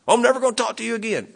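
a light imitation of a huffy retort; mock angry refusal. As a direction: toss it off fast like a sulking brother. Imitating angry person refusing reconciliation.

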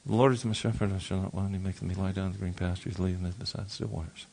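low, soft muttering of "The Lord is my shepherd", words barely voiced; muttered scripture. Shown as a demonstration. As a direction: mutter the psalm under your breath, quick and low. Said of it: "In other words, kinda mutter it".

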